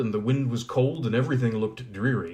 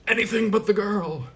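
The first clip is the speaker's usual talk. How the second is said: high, loud, refusing queen; queen's voice; 10 semitones higher, 5 dB louder than usual. Acted. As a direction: cry it out as the haughty queen.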